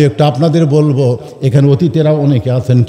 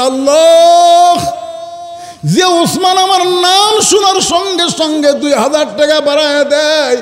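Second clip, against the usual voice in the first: high, drawn-out wailing chant; tearful plea; 11 semitones higher, slower than usual. Acted. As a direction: cry out to God in a high lament. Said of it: "আল্লাহ নবী কান্দের বলে".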